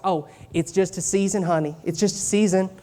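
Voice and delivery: put-on sweet church-lingo Southern accent. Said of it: "I'm doing this in a Southern accent".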